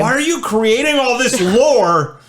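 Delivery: exasperated. Real, not acted. Exasperated at partner's made-up lore.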